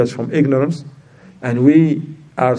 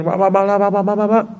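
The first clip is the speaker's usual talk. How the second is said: mock ranting babble; 6 semitones higher than usual. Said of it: "If they start again".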